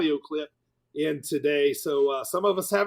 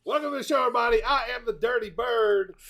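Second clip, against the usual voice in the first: host intro; 7 semitones higher than usual. Shown as a demonstration. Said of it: "Let me start over again".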